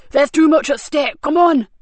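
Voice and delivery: slap him down with it; screaming. Acted. Cox screams at his panicking thug, furious.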